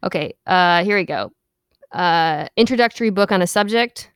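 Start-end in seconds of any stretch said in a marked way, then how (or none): none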